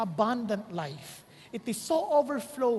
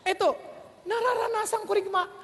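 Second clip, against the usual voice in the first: ordinary delivery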